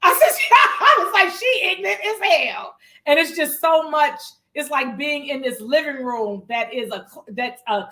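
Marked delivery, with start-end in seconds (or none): 0.0-2.8 loud, high, laughing outburst; shrieky, delighted retelling through laughter; laughing exclamation. Real, not acted. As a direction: crack up while delivering the punchline. Cracking up retelling first impression.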